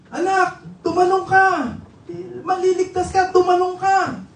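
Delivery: high-pitched acted calling to his son; father urging. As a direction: urge the boy to jump, calling up high. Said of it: "Gumagano siya". (pasigaw na paghimok ng ama)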